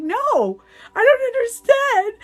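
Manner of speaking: very high, loud comic wail; mock crying. Crying girlfriend telling boyfriend it isn't monthly, mock crying. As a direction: wail it like a hammed-up crybaby. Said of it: "'cause I was just crying".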